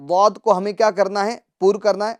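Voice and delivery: ordinary delivery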